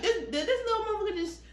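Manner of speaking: high, fast, startled; shocked disbelief. Retold or imitated. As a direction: gasp it out like she can't believe it. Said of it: "She like".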